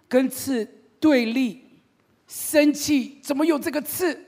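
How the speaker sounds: put-on high, loud childish voice scolding the splinter; baby talk. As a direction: talk like a toddler smacking a naughty thing.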